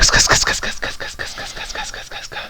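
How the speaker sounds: unvoiced ska syllables, fading away; a vocal warm-up chant of ska, whispered and fading; whispered ska drill. Shown as a demonstration. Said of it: "they can go like".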